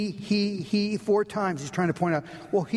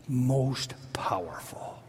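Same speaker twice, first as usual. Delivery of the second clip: awed whisper; 5 semitones lower, 3 dB quieter, much slower than usual. Acted. Preacher stresses God's power.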